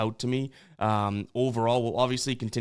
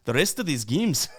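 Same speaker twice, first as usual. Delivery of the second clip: the rest of these games in a jokey put-on Irish lilt, rising at the end; hammed-up vague Irish brogue on a throwaway question; goofy mock Irish accent; 3 semitones higher than usual. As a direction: toss the line off in a random silly Irish accent. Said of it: "I don't even know what accent that is. Irish?".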